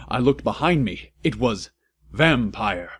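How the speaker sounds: ordinary delivery, nothing marked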